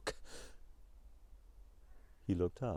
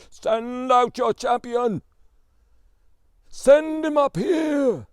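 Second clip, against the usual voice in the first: huge, slow, booming giant roar, much higher and louder; giant bellowing; 11 semitones higher, 13 dB louder, slower than usual. A narrator's reading. Giant on the mountain challenging the Tsar's palace, bellowing. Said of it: "bellowing at the top of his voice".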